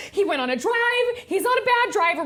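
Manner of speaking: mock panic.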